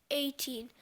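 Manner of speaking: plain reading voice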